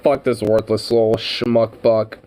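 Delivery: usual speaking voice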